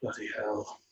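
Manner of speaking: weary sigh. Acted. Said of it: "sort of sigh and go".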